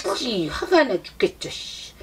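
muttering angrily to herself, low and soft; a sulky spirit child muttering in anger; low grumbling mutter. Acted. Spirit sulking, angry at mother.

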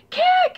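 high, loud startled gasp; high loud cry of alarm; shocked gasp. Acted. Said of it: "he gasped".

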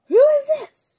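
high, loud demanding shriek. A narrator's reading. Dracula demanding his daughter explain the stranger, high shrill shout. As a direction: demand to know who this stranger is. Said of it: "Dracula demanded".

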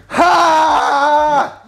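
a sudden strained yell of effort; pained shout. Real, not acted. To the camera, straining through the rep, a loud pained shout. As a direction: cry out mid-rep, full force.

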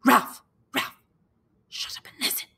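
loud cry of a name, then a hushed order; boy gripping his friend as something prowls; terrified hiss. Acted. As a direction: panic held down to a whisper. Piggy terrified, grabbing Ralph.

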